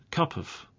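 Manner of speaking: "cup of" joined as one word, cuppuv; linked words. Shown as a demonstration. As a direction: run the p straight into weak "of". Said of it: "is joining with the next word".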